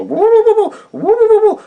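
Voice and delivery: high, loud woo calls; shooing calls. Retold or imitated. Shooing the chicken out of traffic with loud woos. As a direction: herd the chicken off the road with calls. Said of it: "trying to shepherd it".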